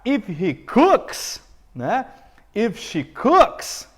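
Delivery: each word drawn out, stress on cooks, rising; slow model sentence for students; slow, stressed demo. Shown as a demonstration. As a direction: spell it out slowly for the class. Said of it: "Basta você pegar a ação".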